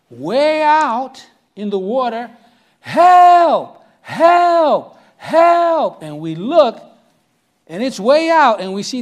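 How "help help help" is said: loud, high cries of Help; cry for help. Retold or imitated. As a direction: shout for help from far out on the water. Distant cries from capsized canoe.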